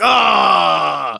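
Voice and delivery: exasperated shout. Retold or imitated. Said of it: "And you're like".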